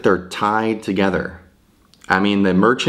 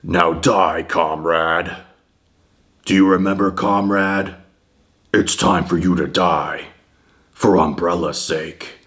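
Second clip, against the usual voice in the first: quoting old Krauser to viewers, gruff menacing imitation; Krauser impression. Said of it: "He's like".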